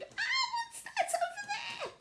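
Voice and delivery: high, slow frightened scream. A narrator's reading. Violet screaming in fright at something in the dark. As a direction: shriek it out in terror. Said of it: "suddenly she screamed".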